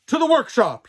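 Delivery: loud, high cry; rally everyone with a shout; urgent, loud call to action; cried out. A narrator's reading. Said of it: "he cried".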